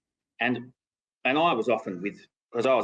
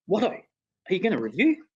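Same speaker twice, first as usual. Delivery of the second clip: surprised question; 10 semitones higher than usual. Retold or imitated. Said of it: "and I'm like".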